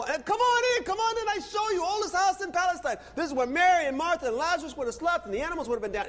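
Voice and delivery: very high, eager local tour guide; delighted host. Retold or imitated. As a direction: beckon visitors in with high, loud excitement. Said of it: "having the most incredible time".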